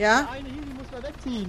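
over helicopter. Real, not acted. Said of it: "ich verstehe Sie nicht". (über den Hubschrauberlärm rufend)

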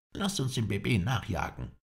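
high-pitched urging; calling out. A narrator's reading. Halef urges a chase after the escapee, calling out. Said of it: "rief Halef".

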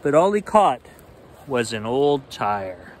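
lively bedtime read-aloud; animated picture book reading; story narration. A narrator's reading.

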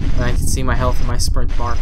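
ordinary talk, nothing marked

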